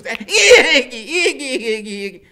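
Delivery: loud, high laughing shout; bursting with discovery; excited realization. A narrator's reading. Loretta thrilled to recognize Kenny, loud and high. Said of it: "Loretta jumps up".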